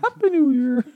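squeaky high cartoon mouse; tiny cartoon critter voice, way up high. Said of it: "cartoon mouse crawling out of a champagne bottle".

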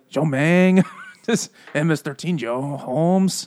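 drawn-out cartoon Mexican accent; bad Mexican accent. Imitating the birds' talk, bad Mexican accent. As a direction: put on a broad mock Mexican accent. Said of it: "Like Speedy Gonzalez".

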